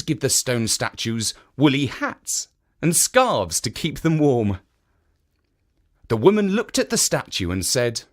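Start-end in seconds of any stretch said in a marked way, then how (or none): none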